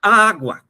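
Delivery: first A of "agua" drawn out high and loud; "agua" with a long, emphatic first A; stress on first A. Shown as a demonstration. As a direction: lean hard on the opening A in "agua". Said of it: "Se encuentra en la primera".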